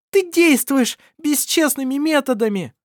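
high, loud indignant shout; indignant cry. Acted. Shira crying out indignantly at Shama, high and loud. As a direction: cry out in outrage. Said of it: "крикнула она возмущённо".

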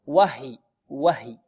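"وهي" as short "wah-hi", pitch low; stop after still h. Shown as a demonstration. Teacher shows stopping on it, still h. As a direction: sound the h in "وهي", then a brief ending. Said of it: "كيف نقف عليها؟".

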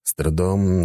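usual speaking voice

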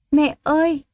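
put-on a little boy bursting into tears; child sobbing "Mẹ ơi"; child crying out. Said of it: "Nghe tiếng con khóc".

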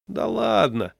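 high, loud brush-off; dismissive. A narrator's reading. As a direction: wave the objection away. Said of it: "отмахнулся Гришкин". (небрежно отмахиваясь)

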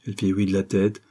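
usual speaking voice

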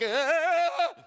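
long, high-pitched "aah"; imitated scream, rising high; mock scream. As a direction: scream like a terrified man, hammed up. Imitates man charged by lion.

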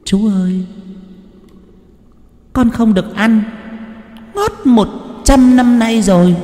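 put-on high, eerie little girl's voice; ghost girl voice. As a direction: sing it sweetly like a dead child begging. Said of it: "Con bé nọ nói với chú Tính".

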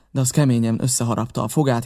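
usual speaking voice

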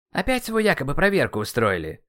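indignant. A narrator's reading. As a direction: protest at yet another injustice. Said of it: "возмутился ацтек".

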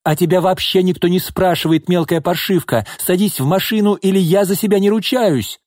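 high-pitched, heated scolding; angry threat. A narrator's reading. Mother ordering daughter into the car, angrily threatening. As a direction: snub the child and threaten her.

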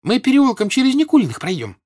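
high, loud call; shouting girl. A narrator's reading. Servant girl shows Pierre another route, shouting. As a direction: holler directions in a high girlish voice. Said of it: "крикнула девка".

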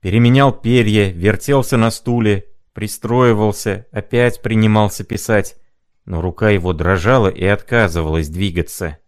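usual speaking voice

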